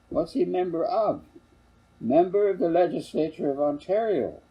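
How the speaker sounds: a hint of a curious child; lightly voiced child. Child and father exchange.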